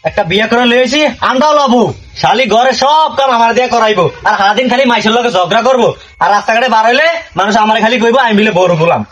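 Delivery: high-pitched, henpecked husband's grumbling; exasperated lament. Acted. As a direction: moan on and on about home life, pitched high. Husband laments his bossy wife alone. (চড়া গলায় আক্ষেপ আর গজগজ)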